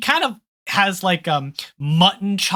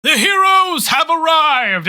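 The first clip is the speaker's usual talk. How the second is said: a loud, much higher, slow wizard proclamation; proclaiming to the crowd; 10 semitones higher, 6 dB louder than usual. Acted. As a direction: announce the heroes to the whole crowd. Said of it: "he says".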